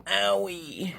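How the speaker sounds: hammed-up hurt character; mock pained yelp. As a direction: act out a silly cry of pain. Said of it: "he's like".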